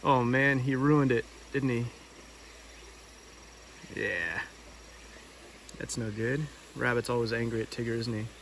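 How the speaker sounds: usual speaking voice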